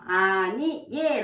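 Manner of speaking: normal talking voice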